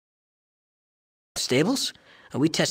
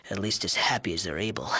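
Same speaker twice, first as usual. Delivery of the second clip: snide whisper; 6 semitones lower, 7 dB quieter, faster than usual. Acted. Snide aside about the Mordinos.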